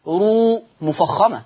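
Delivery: رو said slowly with a full, heavy ر; heavy r demo. Shown as a demonstration. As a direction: stretch رو with a thick ر. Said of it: "حكم الراء المضمومة عند حفص". (راء مفخمة ببطء)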